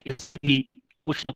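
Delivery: choppy online audio; bad connection. Real, not acted. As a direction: voice breaking up on call. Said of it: "doctor साहब, आपकी आवाज break हो रही है।".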